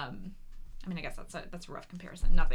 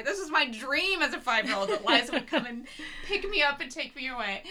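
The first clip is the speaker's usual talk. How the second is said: laughing; 7 semitones higher than usual. Real, not acted. Fond laughing recall.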